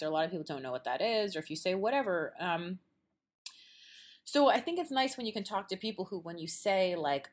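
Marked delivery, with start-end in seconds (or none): none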